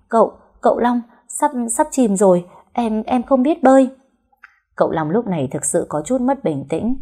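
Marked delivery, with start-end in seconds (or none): 0.1-3.9 high-pitched, stammering panic; frightened. A narrator's reading. Wife to husband as the boat sinks, frightened. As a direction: stammer it out higher, scared of sinking. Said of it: "Liên sợ hãi".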